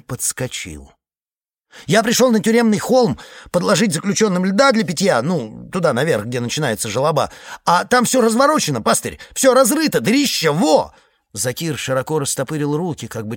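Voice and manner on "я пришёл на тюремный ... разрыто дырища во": high, loud, agitated telling; excited high report. Acted. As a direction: blurt it out, worked up. Excited iceman telling the find.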